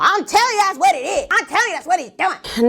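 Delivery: high, insistent mom voice; imitating mom. Voicing mom's stubborn theory.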